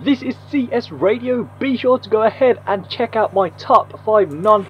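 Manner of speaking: boosted AM radio filter; muffled voice coming over the radio; radio effect. Shown as a demonstration. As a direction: speak as if through a radio. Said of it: "that one probably sounds much more better".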